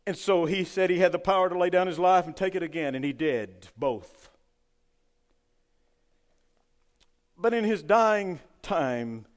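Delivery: loud preaching, slowing on the last lines; fiery preaching. Acted. Preacher to his congregation, loud slowing sermon. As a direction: drive it home to the church.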